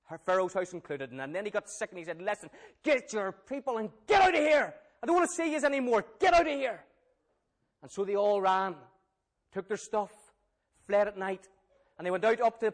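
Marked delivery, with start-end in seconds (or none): 2.2-6.8 louder, higher, furious king ordering them out; angry pharaoh. Acted. Pharaoh ordering Israel out, angry and loud. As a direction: bark it like a fed-up king throwing them out. Pharaoh angrily expels the people.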